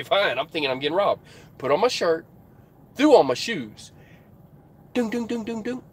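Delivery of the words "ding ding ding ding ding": higher, softer vocal knock; light knock sound. Retold or imitated. Trucker imitating a lighter knock on his truck, soft tapping noise. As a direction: mimic a gentle tapping. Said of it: "A lighter knock".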